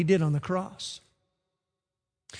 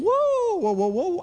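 a sharp, much higher first whoa, then settling; alarmed whoa; 10 semitones higher than usual. Retold or imitated. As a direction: pull back in mock alarm at the pilot. Imagined passenger alarmed by pilot.

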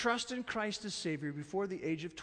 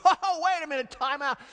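laughing, higher and louder; mock-exasperated disciples; 7 semitones higher, 8 dB louder than usual. Disciples shooing parents with strollers, hammed-up. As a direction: shoo the strollers away in mock panic. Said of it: "they're going".

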